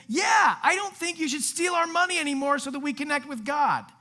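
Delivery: high, loud acted cry from the crowd; emphatic agreement. Crowd backing Jesus against money-taking priests, emphatic agreement. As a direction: call it out like a fired-up crowd.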